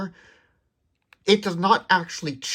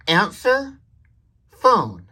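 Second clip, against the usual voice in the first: high rising Answer, then a pause before phone; voice command demo; 6 semitones higher, 3 dB louder, much slower than usual. Shown as a demonstration. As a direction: call it out to the phone with a gap. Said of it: "you could say something like".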